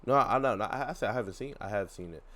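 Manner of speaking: usual speaking voice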